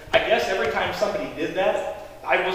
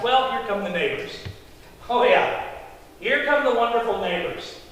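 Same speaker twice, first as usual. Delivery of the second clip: ordinary delivery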